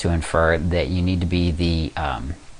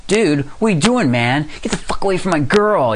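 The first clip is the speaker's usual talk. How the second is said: aggro; 10 semitones higher than usual. Retold or imitated. Man confronting a rival talking to his girl, mock aggro shout. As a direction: act out getting in the guy's face. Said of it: "I got that aggro thing going on".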